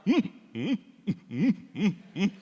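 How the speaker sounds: mock muffled sobs of a little girl; imitated child whimpering. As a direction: whimper like a scared child in her room. Daughter sobbing in bedroom.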